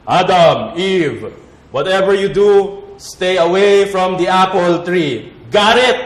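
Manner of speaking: loud commanding God voice, slowing on the order; stern God warning. Acted. God warning Adam and Eve off the apple tree, loud and stern. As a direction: boom the warning like a strict father. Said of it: "God was like".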